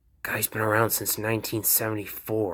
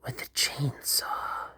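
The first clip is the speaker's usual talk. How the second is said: slow breathless whisper; 3 semitones higher, 7 dB quieter, slower than usual. Acted. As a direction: whisper it like a dark secret. Makima whispers all her lines.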